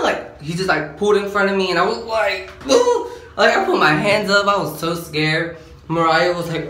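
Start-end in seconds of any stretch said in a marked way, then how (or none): 2.6-3.1 squealing high woo of fright; a shrill falsetto woo of panic; startled woo. Retold or imitated. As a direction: yelp it out high as the car cuts in. Nearly run over by cop.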